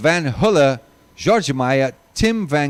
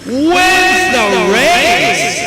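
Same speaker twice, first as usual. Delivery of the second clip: huge drawn-out shout of race, pitch soaring; stretched winning call; 14 semitones higher, 7 dB louder, much slower than usual. Acted. Commentator calling the winner with a long shout. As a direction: hold the word like a victory cry.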